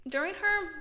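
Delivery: breathless, gasping for air; out of breath. Real, not acted. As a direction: run out of air mid-sentence.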